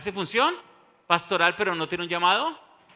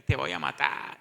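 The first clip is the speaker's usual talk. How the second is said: much lower, menacing growl-like voice; retold demonic threat; 11 semitones lower than usual. Preacher voicing a possessed person during a deliverance, low menacing threat. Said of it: "Grita y".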